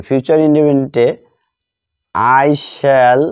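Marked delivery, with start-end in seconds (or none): none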